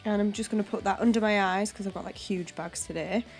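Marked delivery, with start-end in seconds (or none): none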